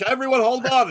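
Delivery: high, loud call for order. Retold or imitated. Entertainer to bickering Zoom guests, calling out. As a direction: imitate someone yelling over chaos. Performer calls out to chaotic guests.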